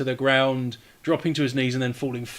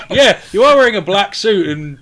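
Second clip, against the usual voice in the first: normal talking voice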